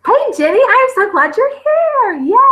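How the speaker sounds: light up as a friend arrives; excited greeting. Real, not acted. Greeting a viewer who joined.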